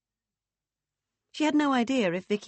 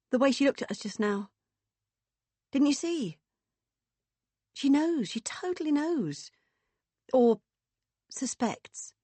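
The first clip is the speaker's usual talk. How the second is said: high, agitated urgency; anxious insistence; 8 semitones higher than usual. Acted. Woman fearing they've been found out, anxious. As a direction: press the point, rattled and worried. Beth frightened they are found out.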